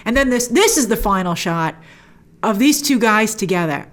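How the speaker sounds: ordinary talk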